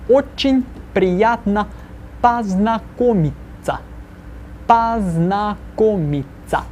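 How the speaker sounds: slow, careful model phrase; drawn out slowly, word by word; slow repeat-after-me. Shown as a demonstration. Teacher slows down so students can repeat the greeting. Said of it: "this painfully slowly".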